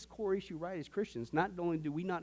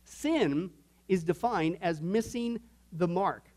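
very slow, every word spelled out for notes; slow dictation; much slower than usual. Shown as a demonstration. Preacher dictating a fill-in blank to the congregation, very slowly. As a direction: dictate it slowly for the note-takers. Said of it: "Sin is your next blank".

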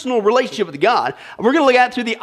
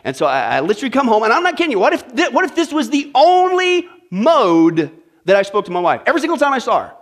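ordinary talk, nothing marked

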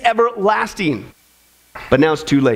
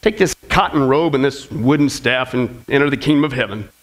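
offhand and much lower, unimpressed; casual; 9 semitones lower than usual. Acted. Saint Peter casually tells the minister his reward, offhand and low. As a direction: toss it off like it's no big deal. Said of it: "he kinda casually says to the minister".

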